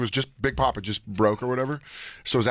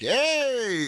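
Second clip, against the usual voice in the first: mock greeting call; 13 semitones higher than usual. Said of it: "I was like".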